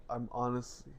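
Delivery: quiet slurred drunk imitation. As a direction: mumble it like you're tipsy. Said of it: "You're doing your drunk voice".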